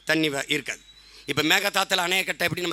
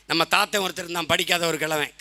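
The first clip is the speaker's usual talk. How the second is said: normal talking voice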